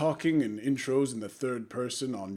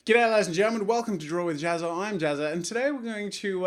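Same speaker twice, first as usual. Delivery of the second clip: higher, louder upbeat host greeting; show intro; 5 semitones higher, 4 dB louder than usual. Shown as a demonstration. Vlogger greets viewers in an upbeat, louder host voice. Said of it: "let's start again".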